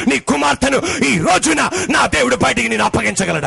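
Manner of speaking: loud, high, sung-out preaching cadence; the pastor calls out the promise over music, chanting and shouting; preaching at full force. Acted. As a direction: chant it to the congregation like a revival climax.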